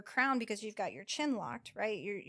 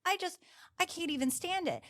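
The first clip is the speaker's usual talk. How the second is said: raised, worked-up protest from the client; imitating her incredulous upset; pitched-up retold dismay; agitated disbelief; 6 semitones higher than usual. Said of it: "And she was like".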